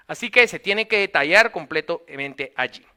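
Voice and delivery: ordinary talk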